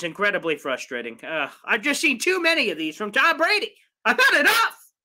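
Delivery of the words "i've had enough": higher, louder, fed-up cry; a fed-up fan blurting it out; fed-up outburst. Real, not acted. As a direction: burst out that you're done with it. Fed up with Brady beating Jets.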